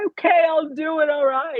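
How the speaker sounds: high, breaking voice through sobs; tearful, choked agreement; crying. Real, not acted. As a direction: agree while still weeping. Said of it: "trying to get through all the sobs".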